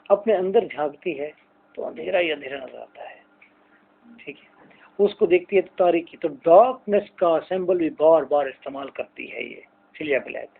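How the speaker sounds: normal talking voice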